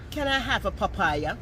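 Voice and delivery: put-on foreign-style question, rising; asking the country vendor in a foreign-sounding accent. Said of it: "So I said".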